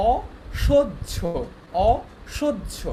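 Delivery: অসহ্য slowly split as o, shoj-jho; slow teacher's o... shoj-jho for অসহ্য; syllable-by-syllable pronunciation. Shown as a demonstration. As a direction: sound out অসহ্য with a pause after o. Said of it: "অসহ্য কি উচ্চারণটা দেখো".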